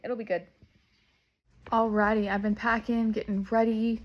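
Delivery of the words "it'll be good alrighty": ordinary delivery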